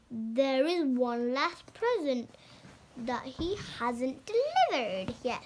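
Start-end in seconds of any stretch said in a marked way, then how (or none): none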